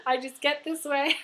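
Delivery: apologetic and choked up; crying. Real, not acted. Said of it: "I'm sorry I'm crying".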